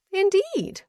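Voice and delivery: put-on confident young lady answering the earl, high and coy. A narrator's reading. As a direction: tease him with a bright high question.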